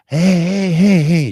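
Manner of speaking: high, loud cheering calls; cheerleaders calling. Retold or imitated. Crowd leaving game, mimicking cheerleaders' high loud calls. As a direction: chant it like a cheerleader.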